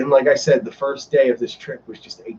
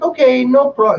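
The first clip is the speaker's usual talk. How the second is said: high, loud Australian lilt; Australian accent; 9 semitones higher, 5 dB louder than usual. Retold or imitated. Ride attendant answering a guest, mimicked Australian accent. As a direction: sing it out in a chirpy Australian accent. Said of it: "she was Australian".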